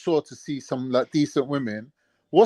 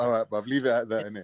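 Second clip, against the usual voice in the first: resigned; 7 semitones lower, 8 dB quieter than usual. Retold or imitated. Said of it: "I was like".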